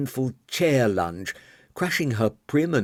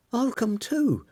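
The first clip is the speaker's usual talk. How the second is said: put-on young woman voice; 13 semitones higher than usual. Marian offering to go.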